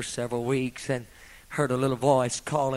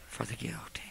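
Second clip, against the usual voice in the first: whisper; 2 semitones lower, 10 dB quieter than usual. Real, not acted. Hushed close of refrain.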